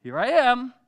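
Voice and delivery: high, loud, eager here I am; eager answer. Acted. Samuel eagerly answering the voice he heard. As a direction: report for duty brightly. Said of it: "Samuel wakes up".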